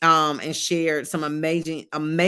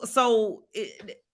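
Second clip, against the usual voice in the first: usual speaking voice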